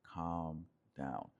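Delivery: low, soft calming tone; voice brought down. Shown as a demonstration. Man settling his upset woman, low voice. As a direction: sink the pitch to settle her. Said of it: "bring your voice or your tonality back down".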